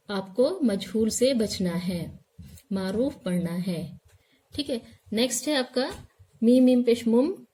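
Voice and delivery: normal talking voice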